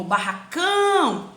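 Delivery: "barracão" with a drawn-out final "cão"; stressed last syllable. Shown as a demonstration. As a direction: lean long on the "cão" of "barracão". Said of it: "a sílaba tônica é a última". ("barracão" com o "cão" final alongado)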